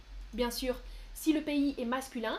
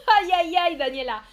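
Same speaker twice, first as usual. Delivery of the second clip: laughing groan; 5 semitones higher, 11 dB louder than usual. Real, not acted. Laughing at her own mistake.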